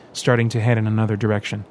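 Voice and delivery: ordinary delivery, nothing marked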